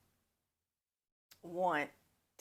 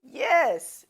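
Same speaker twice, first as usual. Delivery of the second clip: big loud high "yes"; loud, high triumphant yes; emphatic yes; 10 semitones higher, 16 dB louder than usual. Real, not acted. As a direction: slam the point home. Emphatic cap to the story.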